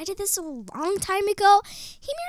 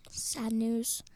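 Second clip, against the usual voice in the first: whispered, lower and softer; hushed bad news; 7 semitones lower, 8 dB quieter than usual. Host breaking sad news to Siri, hushed. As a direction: break it in a mock-grave whisper.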